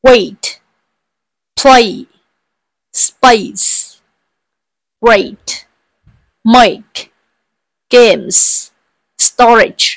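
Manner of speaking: each word "Wait", "play", "space", "great", "make", "games", "storage" said alone, slowly and clearly; teacher modelling a word list; slow word drill. Shown as a demonstration. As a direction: pause after every word for the class to repeat. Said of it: "lặp lại theo cô nhé".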